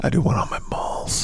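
sly whispered aside; conspiratorial whisper about a gross habit. Acted. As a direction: hush it like a secret. Joke confession, half-whispered.

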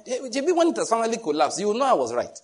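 a wife taunting her husband over his business; mock grumbling wife. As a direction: gloat that you were right.

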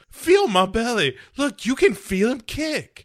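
high, hammed-up unhinged mother voice; mock manic mother. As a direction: squeal it like a mad mother. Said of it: "grabs his hand and goes".

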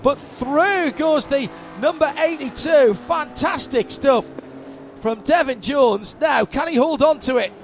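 rising, fast race call; excited play-by-play. Real, not acted. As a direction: call the overtake live. Live play-by-play.

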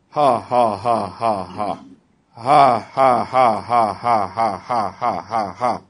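joyless, evenly spaced ha-ha, dropping in pitch; dry forced laugh. Retold or imitated. Sick man laughing at the devil, dry forced laugh. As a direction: fake a laugh with no mirth in it. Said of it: "you see how dry it sounds".

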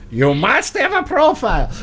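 put-on comically high-pitched German accent; mock German accent. Host imitates Google as a stern German, mock German accent. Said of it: "Google all of a sudden is German".

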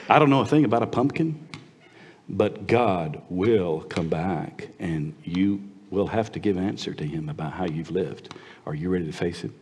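soft, low, measured reply; quiet, earnest warning; friendly but serious. Retold or imitated. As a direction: answer the mocker calmly and gravely.

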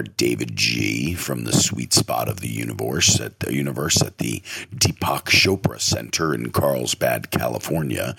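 very low, slow meditation-guide voice; David G. Retold or imitated. Host imitating a meditation teacher, low and slow. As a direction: drop far down and draw the words out. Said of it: "That's how he talks".

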